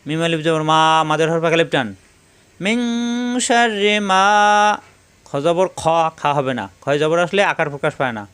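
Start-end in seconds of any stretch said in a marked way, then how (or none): 2.6-4.2 higher sung tilawat; Quran recitation. Shown as a demonstration. Teacher chanting the phrase for students. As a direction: chant শাররি মা melodically.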